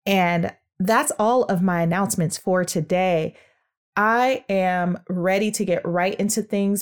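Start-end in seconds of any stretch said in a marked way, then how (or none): none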